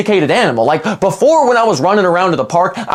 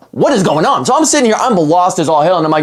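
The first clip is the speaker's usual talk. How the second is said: ordinary talk, nothing marked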